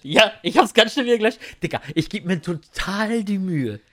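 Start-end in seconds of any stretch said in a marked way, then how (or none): 0.0-1.4 raised, insistent repeat; louder repeat. Real, not acted. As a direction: say it again so they finally hear. Wiederholt lauter, da überhört. (lauter wiederholt)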